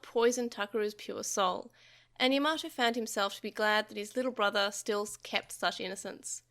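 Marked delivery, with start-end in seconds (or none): none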